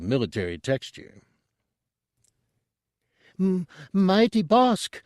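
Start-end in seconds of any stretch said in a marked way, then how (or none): 3.4-5.0 high, slow, stammering little voice; stammering Chadrafan. A narrator's reading. Tooty nervously greeting the huge Trandoshan, high stammer. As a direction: stumble nervously over the first sound. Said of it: "Tooty stammered".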